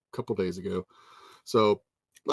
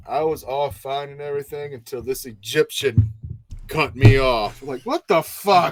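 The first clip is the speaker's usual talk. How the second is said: mock Ric Flair rant; 5 semitones higher, 6 dB louder than usual. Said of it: "And he's like".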